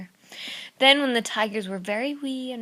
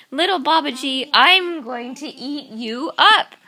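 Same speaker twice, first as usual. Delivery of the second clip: slow, louder threatening tiger; menacing tiger; 4 semitones higher, 7 dB louder than usual. Acted. Second tiger threatening little Babaji, slow menacing voice. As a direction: loom over the boy and threaten slowly.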